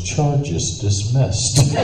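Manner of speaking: very slow, drawn-out pronouncement; judge's ruling. Retold or imitated.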